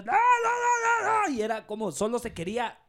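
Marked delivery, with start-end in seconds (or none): 0.0-1.3 high, slow, drawn-out mock shout; shouting lady. Agent imitating the caller who screamed for over an hour, a high drawn shout. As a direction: wail the nos long and high like a furious caller.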